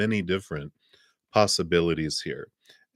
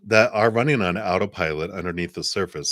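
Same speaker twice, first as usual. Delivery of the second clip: normal talking voice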